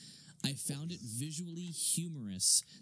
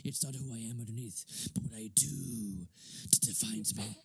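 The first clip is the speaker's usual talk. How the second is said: low, gravelly-free Batman rasp with a whisper; Batman quote; 3 semitones lower than usual. Retold or imitated. Quoting Batman to Rachel, low and half-whispered. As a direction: deliver it like the brooding hero. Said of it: "He goes".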